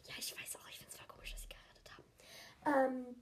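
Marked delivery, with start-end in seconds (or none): 0.1-1.9 soft unvoiced whisper. Real, not acted. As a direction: whisper it aside. Aside whispered.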